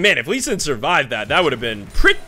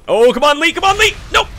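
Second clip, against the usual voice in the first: loud plea rising high; urgent pleading; 9 semitones higher, 5 dB louder than usual. Real, not acted. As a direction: urge a teammate on, loudly. Urges teammate on during fight.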